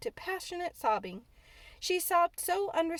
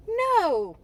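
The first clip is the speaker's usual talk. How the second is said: indignant no, higher and louder; girl refusing, indignantly loud; 10 semitones higher, 10 dB louder than usual. A narrator's reading. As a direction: snap it back, offended. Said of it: "answered Mary quite indignantly".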